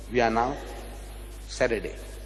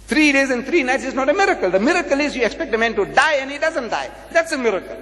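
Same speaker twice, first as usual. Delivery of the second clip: raised-pitch, insistent arguing; emphatic retort; 7 semitones higher than usual. Retold or imitated. Preacher rebuts an opponent over the miracle, emphatic retort. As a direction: press the point home, pitched up.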